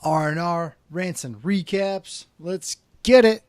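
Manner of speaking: high, loud podcast opener; pumped-up host kicking off the show; hyped show intro. Real, not acted. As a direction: belt out the welcome, stretching the big words. Hyped opening of his podcast.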